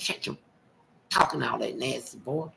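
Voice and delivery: mock hushed mother scolding her son. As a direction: quietly shush the kid, embarrassed. Mama hushing son in store.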